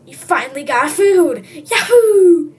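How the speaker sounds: loud, slow, laughing cheer; overjoyed. Acted. Hungry kid finally getting food, loud cheer. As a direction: celebrate at last.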